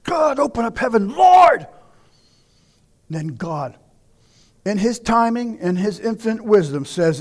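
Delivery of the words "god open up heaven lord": from a pleading whisper up to a crying shout. Retold or imitated. Moses crying out to God, rising from whisper to shout. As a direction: beg God, building from a hush to a high cry. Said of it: "kept pleading and crying out".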